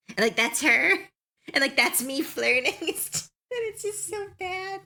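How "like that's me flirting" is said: normal talking voice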